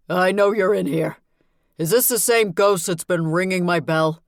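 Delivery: angry at being scared; trembling with fear and rage. Acted. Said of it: "His voice trembled with both fear and rage".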